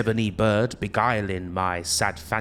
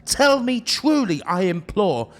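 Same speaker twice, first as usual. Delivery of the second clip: much higher and louder, begging; desperate pleading cry; 8 semitones higher, 4 dB louder than usual. Acted. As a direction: implore with rising desperation. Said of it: "said I".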